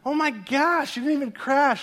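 high-pitched mock exasperation; exasperated dad. Dad to crying daughter after bike stop, exasperated. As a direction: protest in exaggerated disbelief.

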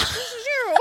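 very high, giggly little voice; mock cartoon graphic voice. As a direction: squeak it through rising laughter. Voicing the little Neanderthal graphic, laughing.

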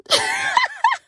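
high burst of laughter; shrieking laugh. Real, not acted. Cracking up while voicing God's solemn line, shrieking laughter. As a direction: cracks up at her own joke.

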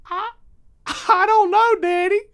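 put-on high, loud little boy; high child voice asking Daddy; young son's voice. Son answers his father's quiz.

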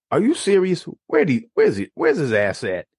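a mother ready to fight; a hint of a furious mom; indignant mom. Mom angry at the player.

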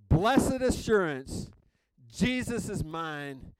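slow, loud and high proclamation; ringing, emphatic declaration; emphatic exclamation. Shown as a demonstration. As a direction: declare it with an exclamation point, drawn out. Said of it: "I'm emphatic about, exclamation point".

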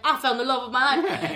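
hammed-up British reality-show guy; mock lovestruck Brit, half laughing; British accent. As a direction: gush in a put-on British accent. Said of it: "funny guy pairs up with her".

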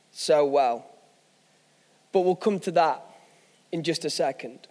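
normal talking voice

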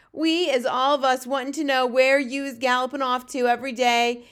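loud, domineering giant; Fleshlumpeater, bullying. A narrator's reading. Bully giant demanding answers from the runty one, booming.

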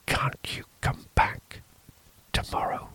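nervous priest in a whisper. A narrator's reading. Priest whispering fearfully to the stranger in the dark. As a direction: keep it hushed and uneasy.

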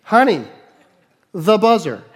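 punchy, spaced-out calling; acted second shout, word by word. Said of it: "I'll do it a little louder".

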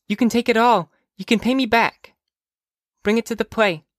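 put-on high child's voice; generous little sister. Sister offering all her money, high insistent child voice. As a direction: urge big brother in a high girlish voice.

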